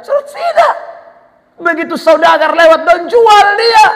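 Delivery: high, rising outburst; indignant. Acted. Preacher tells how brothers sold Joseph, indignant. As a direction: build to a loud, high exclamation.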